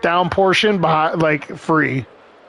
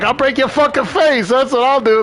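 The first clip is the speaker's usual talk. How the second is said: mock mobster threat; 8 semitones higher than usual. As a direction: menace him like an old gangster. Voicing Sammy the Bull threatening.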